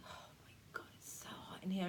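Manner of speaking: soft, breathy overheated whisper; acted hot flush. Teacher showing the hot flush coming back, breathy overheated whisper. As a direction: whisper it as if wilting from heat.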